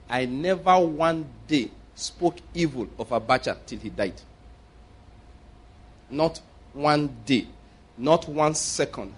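slow, deliberate, spaced-out phrases; solemn, measured insistence. Real, not acted. Preacher testifying he never criticized the ruler, solemn and slow.

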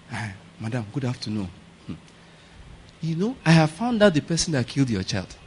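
hushed, confiding visitor; neighbour bringing news. Acted. Neighbour telling a mother who killed her child, hushed. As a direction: softly break grave news, half under your breath. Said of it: "a neighbor now came one day".

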